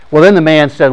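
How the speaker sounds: normal talking voice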